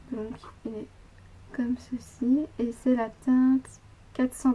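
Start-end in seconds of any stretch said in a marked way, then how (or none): 0.1-0.9 whispering. Real, not acted. Showing shade, unvoiced.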